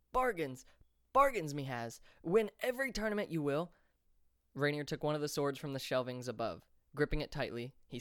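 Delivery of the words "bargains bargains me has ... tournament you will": higher, pushy peddler calling out his wares, building to a peak; eager hawker shout. A narrator's reading. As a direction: sell it like your life depends on it. Merchant hawking loudly.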